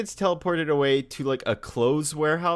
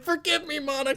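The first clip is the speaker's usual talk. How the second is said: very high mock plea; pleading old man; 12 semitones higher than usual. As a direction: beg like a feeble old man calling out. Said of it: "he calls her out too".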